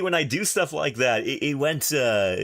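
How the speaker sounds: normal talking voice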